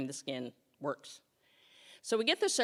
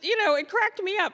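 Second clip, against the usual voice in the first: usual speaking voice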